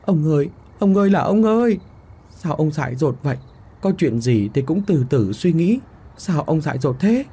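high-pitched, distressed wail; frightened questioning. A narrator's reading. Wife finding her husband on the ground, frightened wailing. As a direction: lament in fright, starting high on the first cry. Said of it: "lúc này còn run sợ mà hỏi".

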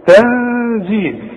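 تنزيل slowly with a nasal hidden ن; slow demo. Shown as a demonstration. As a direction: stretch تنزيل, humming the ن. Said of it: "أغن واخفي".

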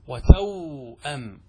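"وتوأم" very slow, wa-tawww... 'am; vowelless waw. Shown as a demonstration. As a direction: hold the w in "وتوأم", then a separate 'am. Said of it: "انظر الواو ساكنة".

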